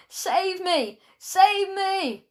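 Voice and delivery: high, pleading cry in a strained voice; scream. A narrator's reading. Malcolm begging his classmates for rescue, screaming. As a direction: beg at a high pitch without full volume. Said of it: "He looked up and screamed".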